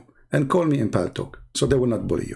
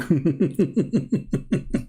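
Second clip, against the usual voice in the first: mocking laugh; 3 semitones higher than usual. Real, not acted. Laughs at own story.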